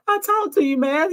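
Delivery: imitating emotional plea. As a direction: replay his hurt disbelief. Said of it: "you can hear the emotion".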